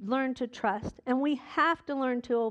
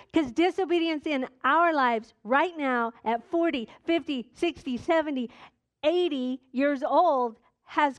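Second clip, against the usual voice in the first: raised, higher preaching voice, building force; 3 semitones higher than usual. Acted. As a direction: preach it to the congregation with rising fire.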